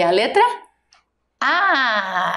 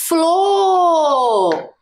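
FLOR held very long on the vowel, higher pitch gliding down; drawn-out syllable; 5 semitones higher, 3 dB louder than usual. Shown as a demonstration. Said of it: "A primeira sílaba". (Flor bem esticado, como uma sílaba só)

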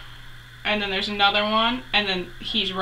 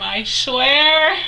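loud, high, stretched warning; playfully overwhelmed, voice rising on a drawn-out swear; mock threat; 5 semitones higher, 6 dB louder than usual. Mock threat over being called sweetheart.

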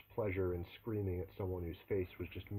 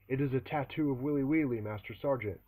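shouted reply; 5 semitones higher, 6 dB louder than usual. Acted. Recruit shouting answers at drill sergeant.

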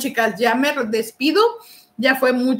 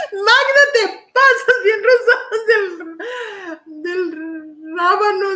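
laughing; 7 semitones higher than usual. Real, not acted. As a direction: tease her while cracking up. Laughing while teasing Magda.

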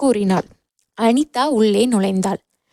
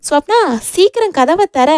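high-pitched, restrained narrated yell; impatient shout; 7 semitones higher, 3 dB louder than usual. A narrator's reading. Rakesh wants Swapna to open the door, impatient shout. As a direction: urge them to hurry, calling out. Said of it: "Rakesh மறுபடியும் கத்தினான்".

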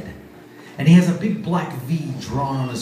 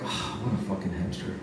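low, much softer grumble; muttered aside; 8 semitones lower, 10 dB quieter than usual. Retold or imitated. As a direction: mutter it under your breath. Friends muttering insult.

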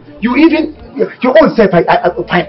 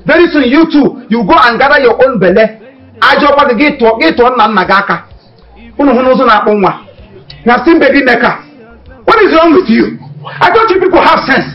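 high, loud, furious yelling; furious shout; 6 semitones higher, 4 dB louder than usual. Acted. Man yelling at the girls who claim pregnancy, furious shout. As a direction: scold them at full volume, outraged.

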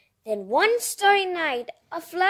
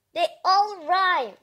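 ordinary delivery, nothing marked

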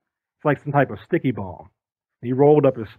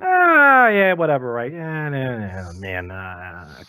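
scoffing dismissal; much slower than usual. Retold or imitated. Other guys still laughing, dismissive.

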